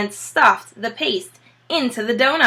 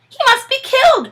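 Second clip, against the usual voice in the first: much higher, loud demand; high angry cry; 6 dB louder than usual. Acted. Bean demanding the fox die, a high loud cry. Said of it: "cried Bean".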